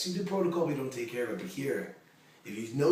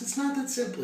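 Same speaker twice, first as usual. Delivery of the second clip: incredulous; 5 dB louder than usual. Retold or imitated. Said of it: "He goes".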